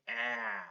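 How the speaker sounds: "ah" said as short a, as in track; short vowel. Shown as a demonstration. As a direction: sound out "ah" like the middle of track. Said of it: "That is a short sound".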